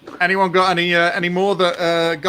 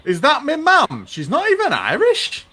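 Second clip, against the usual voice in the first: much higher, comic northern voice; Peter Kay; 13 semitones higher, 3 dB louder than usual. Retold or imitated. Peter Kay's comeback to the bullies, in a high comic voice. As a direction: pitch it way up like a comic. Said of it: "Peter Kay would go".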